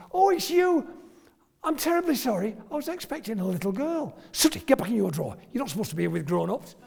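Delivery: hammed-up apology, then fast scolding of the puppet; mock fluster. Mock apology to patient, puppet act.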